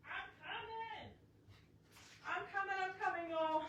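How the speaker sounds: faint and distant, calling from another room; calling from far off. Real, not acted. Crafter calling back to viewers from across the house, distant and faint.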